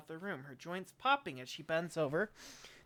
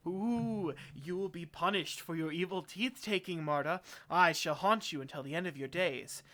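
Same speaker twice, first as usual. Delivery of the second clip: wailing "ooh" into a louder haunting curse; mock ghostly threat; 4 dB louder than usual. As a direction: play a spook threatening to haunt her. Feigned ghostly threat to haunt her.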